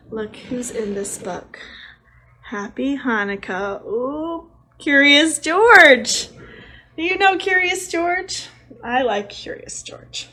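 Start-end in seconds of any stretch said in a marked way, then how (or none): 3.8-8.5 much higher and louder, slow; excited reveal. Real, not acted. Teacher thrilled to show the class Curious George. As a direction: stretch the name out with delight. Revealing familiar character to kids.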